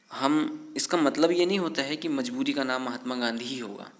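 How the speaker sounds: normal talking voice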